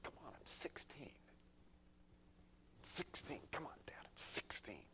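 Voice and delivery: put-on whispered teen grumble; sulky whispered complaint; muttered under breath. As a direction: mutter it so dad can't hear. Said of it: "muttering under my breath".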